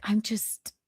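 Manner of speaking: faltering whisper; barely voiced, trailing off; whispered reply. Answering John in front of fans, whispered.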